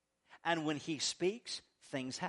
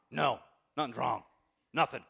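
sulky wife denial; 5 semitones lower than usual. Retold or imitated. Wife voiced in acted quarrel.

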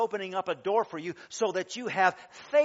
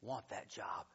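much softer, whispered longing; imitated whisper; 7 semitones lower, 12 dB quieter than usual. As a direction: breathe it like a secret wish. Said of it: "and she goes".

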